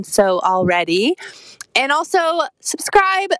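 upbeat host sign-off read; podcast outro. Acted. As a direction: wrap up the show for listeners.

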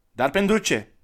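high, loud wary question; a boy who doubts the stranger; suspicious child. A narrator's reading. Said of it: "întrebă copilul bănuitor".

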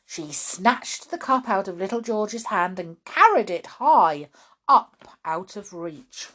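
plain reading voice

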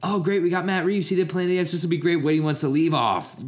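high, excited then panicking; hammed-up exec freaking out; mock studio exec.

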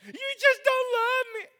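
high, whiny mock of his wife; mimicking wife. Pastor mocking his wife's complaint, high whiny voice. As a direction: whine it like the hurt wife.

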